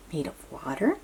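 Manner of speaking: ordinary delivery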